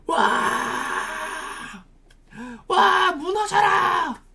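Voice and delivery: acted triumphant shouting; mass battle cry. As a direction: cry out like a crowd storming the walls. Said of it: "큰 소리로 막 외쳤어요".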